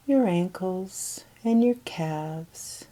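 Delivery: normal talking voice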